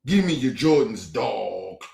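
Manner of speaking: mock a phony street-talk line, dropping low at the end; lightly put-on bozo street voice; mocking imitation. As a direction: scornfully mimic someone faking street slang.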